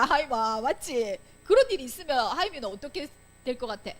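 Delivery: ordinary talk, nothing marked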